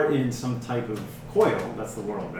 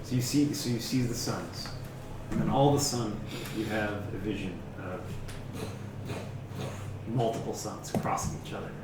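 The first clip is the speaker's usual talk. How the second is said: hushed vision narration; 3 semitones lower, 7 dB quieter than usual. Acted. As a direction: draw them into the vision quietly. GM describes a vision.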